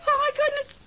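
sudden high scream of fright, then gasping words; startled shriek. Acted. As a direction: shriek it out as if something dashed past you. Something runs through the room.

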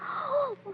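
sharp high gasp of surprise; delighted gasp. Acted. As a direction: gasp at the news. Hears they will elope.

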